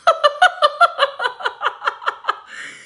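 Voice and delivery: very high staccato laughing run; high, rapid string of ha-has; long giggling laugh. Real, not acted. Host joking that brands avoid her, laughing hard.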